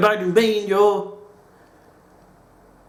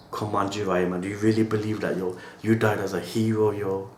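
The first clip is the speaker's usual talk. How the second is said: ordinary talk, nothing marked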